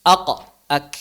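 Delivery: ak with breath released; k sound demo. Shown as a demonstration. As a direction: release air after ak. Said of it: "Cara mengucapkannya mirip". (contoh ak)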